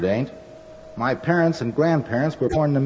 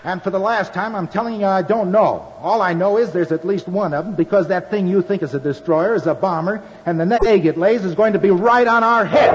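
shouting back; 7 semitones higher than usual. Acted. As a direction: yell it back over the bombs. Sailor yells back under bombing.

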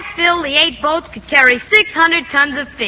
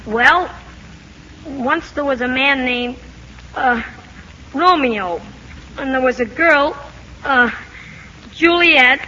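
hesitant, with long searching pauses and uhs; stop-and-start recitation from a poor memory; halting recall. Acted. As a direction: stall between phrases, groping for the names. Boy struggles to remember story.

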